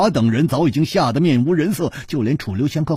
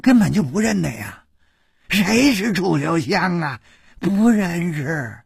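fawning denial; 6 semitones higher than usual. Acted. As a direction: placate them, drawing it out. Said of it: "纷纷陪笑说".

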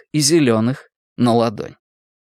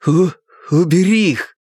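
strained, louder stutter; sharp stammering command; 6 semitones higher, 3 dB louder than usual. A narrator's reading. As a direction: force the words out, stammering. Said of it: "повторил Билл, лицо его перекосило".

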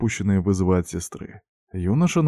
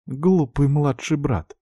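higher, slow sorrowful reproach; tearful sigh; 7 semitones higher, slower than usual. A narrator's reading. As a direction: say it through hurt. Repeated, more tearful.

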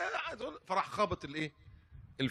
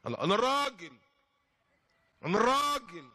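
proud husband boast; 7 semitones higher, 4 dB louder than usual. Retold or imitated.

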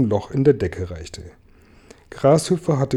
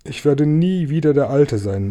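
usual speaking voice

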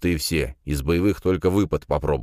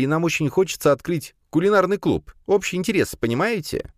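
high, bright-eyed student voice pleading with the rector; a hopeful young man asking a favour; eager young student. A narrator's reading. Student hopefully asks the rector about a club, in a high young voice.

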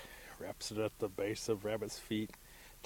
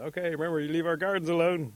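loud, higher-pitched taunt from the winners; calling out a warning; 8 semitones higher, 9 dB louder than usual. A narrator's reading. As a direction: call it after the beaten loser. Winning men call after Rabbit.

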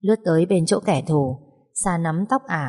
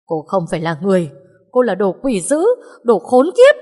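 furious cursing; 3 semitones higher, 7 dB louder than usual. Acted. Husband cursing wife.

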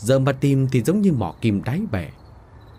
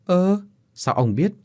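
ngạc nhiên; 6 semitones higher than usual. Acted. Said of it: "Cô vợ ngạc nhiên hỏi lại".

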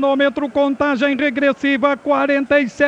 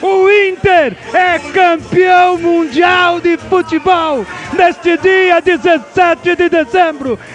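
shouted radio championship call; victory call; 4 semitones higher than usual. Real, not acted. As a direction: belt out the title win. Radio goal call celebration.

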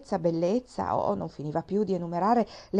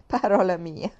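"Parola mia" said through a breaking laugh; a touch of laughing disbelief; 3 dB louder than usual. A narrator's reading. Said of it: "non poté trattenersi dal ridere". (ridendo sommessamente)

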